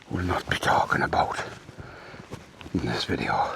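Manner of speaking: hushed, secretive whisper; conspiratorial whisper. Pretend. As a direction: whisper it like a secret nobody should hear. Hushing up the toilet traces.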